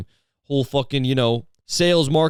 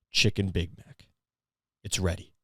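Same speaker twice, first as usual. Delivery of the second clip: low, hushed grave announcement; mock-solemn reveal; 4 semitones lower, 7 dB quieter than usual. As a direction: deliver it like a momentous secret, very quietly. Acted solemn announcement of sandwich.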